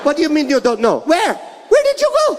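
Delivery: rising to a high shriek; mom shrieking. Retold or imitated. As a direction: go up and up like an angry mom. Mom yelling at kid.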